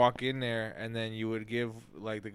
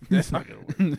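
higher, louder put-on skeptic; mock skeptic voice; 5 semitones higher, 5 dB louder than usual. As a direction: echo it back in a goofy voice. Echoing friend's line in voice.

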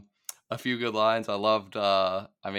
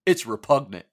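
mock disgusted pronouncement; hammed-up snooty repugnant; quoting the guy; 2 semitones higher, 8 dB louder than usual. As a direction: drop it like a haughty verdict. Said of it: "he goes".

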